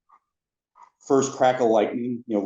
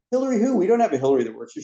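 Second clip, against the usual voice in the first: high-pitched, rapid challenge; nosy girl; 10 semitones higher, much faster than usual. Retold or imitated. Nosy coworker questions the next stall, high, quick prying voice. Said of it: "the girl in there was very nosy".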